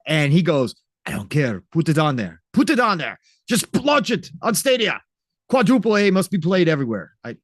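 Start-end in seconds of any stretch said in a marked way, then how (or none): 1.1-7.1 hammed-up bad French accent, rising higher and louder; a mock French boss insisting, thick fake French accent; Yves in a terrible French accent. Stubborn boss brushing off his crew, in a bad French accent. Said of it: "that was my terrible French accent".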